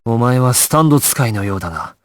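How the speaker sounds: normal talking voice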